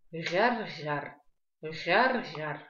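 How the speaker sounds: Ряр-ряр rattled at the back of the mouth; погремушка. Shown as a demonstration. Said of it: "используем подсказку погремушка". (раскатистое «ряр-ряр», как погремушка)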